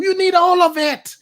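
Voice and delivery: "You need all of it" high and loud; emphatic insistence. Real, not acted. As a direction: insist loudly on "all of it", voice leaping up. Counters the calm-down advice.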